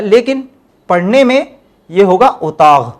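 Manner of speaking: usual speaking voice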